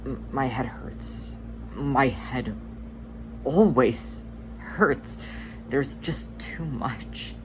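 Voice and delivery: broken, faltering words fading to a whisper; crying quietly, voice dying away; soft sobbing. Acted. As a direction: sob it out softly, trailing off. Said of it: "letting soft sobs collapse out of him".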